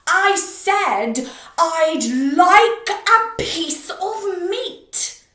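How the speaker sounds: slow, very loud roar. Acted. Mister Noisy roaring slowly at the butcher. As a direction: stretch out each word in a roar. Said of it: "roared Mister Noisy".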